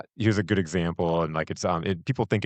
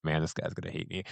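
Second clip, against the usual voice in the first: worried aside; 9 dB quieter than usual. Retold or imitated. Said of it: "I'm like".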